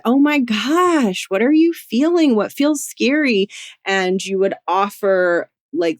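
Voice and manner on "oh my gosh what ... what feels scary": put-on comforting a scared kid. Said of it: "You would say".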